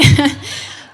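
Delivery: short, loud breathy laugh. Real, not acted. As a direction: laugh briefly at your own joke. Joking about pastor's old photo.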